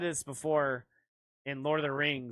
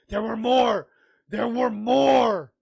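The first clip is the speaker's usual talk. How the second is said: higher, loud movie-quote yell; mock film shout; 6 semitones higher, 9 dB louder, slower than usual. Joker imitating someone out there in a battle, mock shout. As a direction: bellow it, stretching the repeat. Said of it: "Kuda's like".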